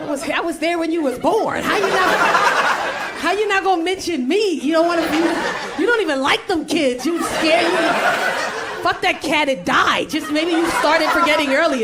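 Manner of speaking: hammed-up indignation. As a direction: rant like an offended sibling, playing it up. Acted outburst at being left out.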